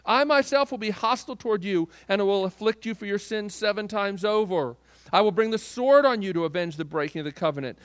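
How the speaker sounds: loud, driving reading of God's threats; forceful scripture reading. Acted. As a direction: proclaim it like a prophet of judgment. Reads God's curses aloud.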